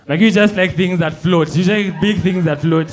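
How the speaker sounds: loud, biting sarcasm; sarcastic jab. Retold or imitated. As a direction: sneer the comeback. Sarcastic comeback to the girl.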